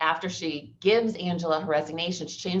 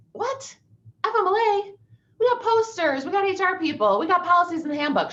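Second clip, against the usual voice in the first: high, loud mock outrage, much higher than her own voice; mock indignant disbelief; 5 dB louder than usual. Lawyer mocking the school district's denial, high indignant mock voice. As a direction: ham up a shocked employer's protest.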